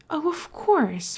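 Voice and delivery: slow, lofty, airy; nonchalant. Acted. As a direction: brush it off as nothing. Scar nonchalantly waved a hand.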